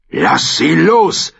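high, slow, emphatic order; shouted command. A narrator's reading. Lord Commander steps in for the woman, shouted command. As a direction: bellow it, stretching each word.

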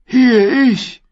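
put-on high, thin old man's voice; a high, frail old man answering; old prisoner. A narrator's reading. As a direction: call out weakly from the cage. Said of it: "sagte er".

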